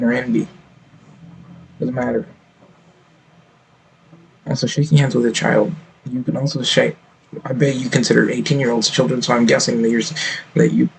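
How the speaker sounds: usual speaking voice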